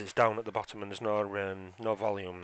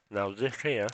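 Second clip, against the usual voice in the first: talking through a mouthful of crisps; crunching crisps while speaking; eating crisps; 5 dB louder, slower than usual. Real, not acted. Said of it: "sorry, I'm eating crisps".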